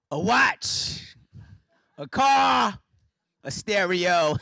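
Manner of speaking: mock boastful old man; proud listing. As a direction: list the loot with pride. Grandpops listing stolen items.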